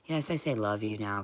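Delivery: ordinary talk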